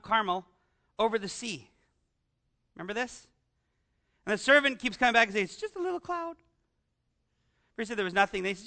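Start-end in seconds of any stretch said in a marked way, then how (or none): 5.5-6.4 higher, softer, offhand little voice; servant's report. Retold or imitated. Servant telling Elijah about the cloud, light offhand voice. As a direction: shrug it off like a servant.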